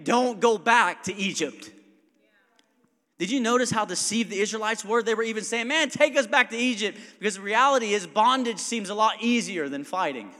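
loud, rising preacher's call about Abraham's action; fiery preaching. Acted. Pastor urging the congregation, preached loud and high. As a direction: build the room up, pushing each line harder.

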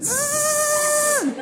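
very high, thin buzzing whine; dental drill whine. Shown as a demonstration. Pastor imitating the machine down the hall, high drill whine. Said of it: "it sounds like this".

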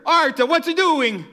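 high, loud, stretched-out holler; mother yelling. Acted. Mom calling to her son from fourth floor, alarmed yell. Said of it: "I could hear her".

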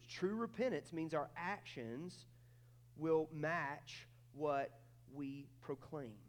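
very slow on will match what; preacher hammering the main point slowly; drawn-out repeat. Shown as a demonstration. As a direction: stretch out the key line. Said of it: "third slow repeat".

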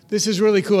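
ordinary talk, nothing marked

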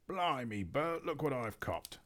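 a light cockney troll showing off his catch; troll William. Acted. William calls Bert over to his catch, lightly voiced troll. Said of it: "said William".

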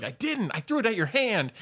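high, emphatic mock indignation; retold heated protest. Arguing back at the man over tossed yogurt, heated protest. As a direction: argue back, pitched well up. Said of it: "became this very heated moment".

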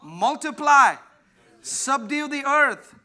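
loud, high preaching cry; preaching at full force. Acted. Preacher thundering the mandate to the church. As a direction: drive it home to the congregation.

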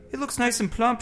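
high, light mother voice; mother's voice. A narrator's reading. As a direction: pitch it up for the mother. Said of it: "she said".

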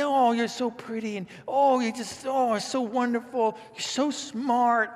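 high, loud doting praise, slow at the end; mock doting relative fussing over you; gushing elderly relative. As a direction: coo like a grandma spoiling a visitor. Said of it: "we think of God like an elderly relative".

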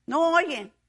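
normal talking voice

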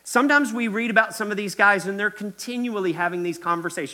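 normal talking voice

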